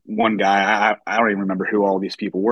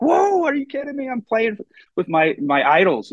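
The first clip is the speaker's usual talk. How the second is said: much higher, giddy squeal of disbelief; thrilled disbelief; 13 semitones higher than usual. Retold or imitated. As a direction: yelp like you just met your heroes. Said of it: "running around my apartment like".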